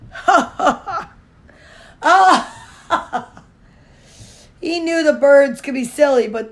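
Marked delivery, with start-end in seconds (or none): 0.1-3.4 hammed-up fox laugh, ha ha ha; loud mocking laugh. A narrator's reading. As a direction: laugh big at the silly birds. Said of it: "laughed loudly".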